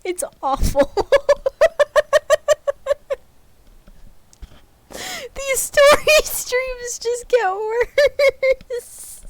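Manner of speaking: high, slow, laughing through words; can't stop the giggles; giggling. Real, not acted. Streamer giggles at her failed accents, laughing. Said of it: "getting the giggle bunnies".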